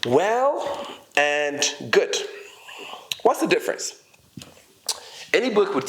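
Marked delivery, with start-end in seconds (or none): 0.1-2.1 each word stretched very slowly; slow emphasis. Shown as a demonstration. Teacher sets out two words, very slowly. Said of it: "So well".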